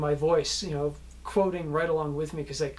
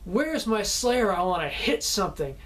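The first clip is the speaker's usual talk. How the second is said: raised, higher mock yell; retold scream; 4 semitones higher, 4 dB louder than usual. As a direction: imitate an angry scream, held back a little. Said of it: "screaming at the top of my lungs".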